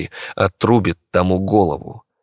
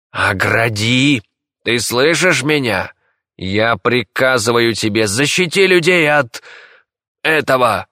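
imperious order climbing higher; emperor's raised-voice order; urgent command; 4 semitones higher than usual. A narrator's reading. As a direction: command a servant urgently, pitch rising to a cry. Emperor orders seer to protect people.

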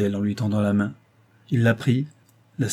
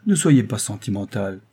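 plain reading voice

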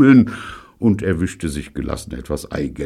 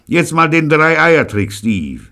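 Pirolo urging; 12 semitones higher, 8 dB louder, slower than usual. Acted. Pirolo urges Steve.

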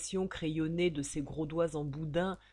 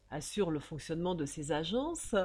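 ordinary delivery, nothing marked

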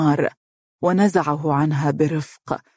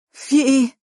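high, louder alarmed shout; startled cry; 9 semitones higher, 4 dB louder than usual. A narrator's reading. Mohra woken in alarm, high startled cry. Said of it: "وهي تصيح".

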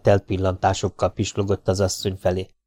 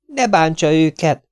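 higher, slow, insistent woman's voice; firm woman's plea; 8 semitones higher, 4 dB louder than usual. Acted. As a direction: repeat it slowly so he listens. Hollóné repeats, slower and firmer. (lassú, magas, határozott asszonyhang)

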